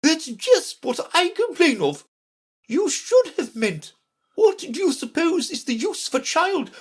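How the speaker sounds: very high-pitched, imperious Red Queen; impatient scolding. A narrator's reading. Red Queen interrupting Alice, high and impatient. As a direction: cut in and lecture from the top of the voice. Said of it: "interrupted her impatiently".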